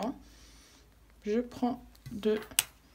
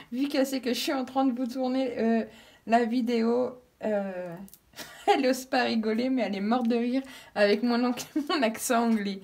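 laughing; 2 semitones higher, 5 dB louder than usual. Real, not acted. Daughter giggling behind her.

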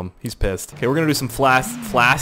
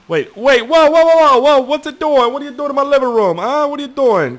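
much higher, louder panicked whoas; alarmed yelling; 13 semitones higher, 6 dB louder than usual. Real, not acted. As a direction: shout at the runaway car. Car goes wild in game.

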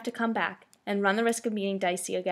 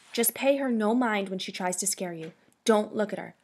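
ordinary delivery

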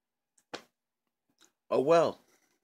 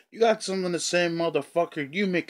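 raised, higher confrontational voice; angry challenge; 8 semitones higher, 5 dB louder than usual. Real, not acted. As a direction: flare up at the troll, pitch jumping. Challenging the troll directly.

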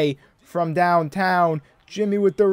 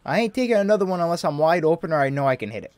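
ordinary talk

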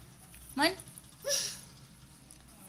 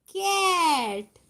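very slow, higher stretched word; drawn-out word; 5 semitones higher, 3 dB louder, much slower than usual. Real, not acted. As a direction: stretch it out for the little one. Mother drawls word to toddler. (लांबवून, उंच आवाजात)

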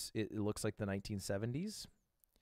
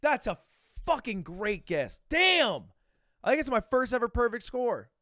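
much higher and louder, yelling with joy; ecstatic shouting; 11 semitones higher, 9 dB louder than usual. Real, not acted. Celebrating his first perfect score, real joyful yelling. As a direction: shout the win to the room.

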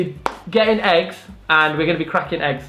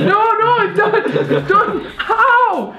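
laughing pained yelps; 3 dB louder, slower than usual. Real, not acted. Egg cracked on head.

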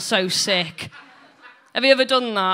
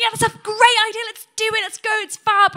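much higher, louder camp squeal; 12 semitones higher, 8 dB louder than usual. Retold or imitated. Mum jumping at the film idea, camp squeal. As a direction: gush like an excited camp friend. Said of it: "She got really camp".

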